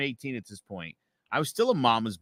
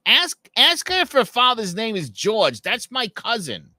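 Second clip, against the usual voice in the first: higher, slower Jersey mom voice; mom's Jersey accent; 10 semitones higher, 6 dB louder than usual. Retold or imitated. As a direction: do the Jersey mother, insistent. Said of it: "my mom has a Jersey accent".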